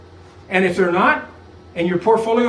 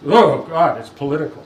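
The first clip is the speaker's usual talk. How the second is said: mock exasperation; 4 semitones lower, 5 dB louder than usual. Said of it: "not because it was".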